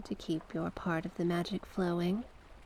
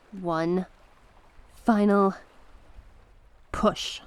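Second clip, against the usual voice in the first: rising to a loud urging cry on the last words; coaxing swell into a loud command; building urgent push; 7 dB louder, slower than usual. Acted. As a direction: drive her into one last effort, louder each word. Urging a final magical push.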